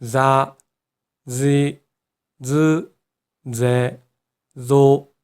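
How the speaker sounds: 자, 지, 즈, 제, 조 with buzzing Japanese z; each syllable separate, starting on a voiced z; voiced z row. Shown as a demonstration. Teacher models the row for learners, voiced z sound in 자, 지, 즈, 제, 조. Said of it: "지읒의 발음이 되는 자행을 보도록".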